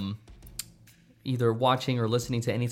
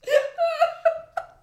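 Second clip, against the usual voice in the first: squealing laugh; 3 dB louder than usual. Real, not acted. Caught out, laughing apology.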